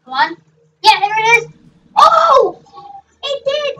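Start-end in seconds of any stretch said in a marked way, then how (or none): 2.0-3.8 high, loud burst of amazement; real thrilled yelp; excited outburst. Real, not acted. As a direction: exclaim as it works. Car hits the boulder.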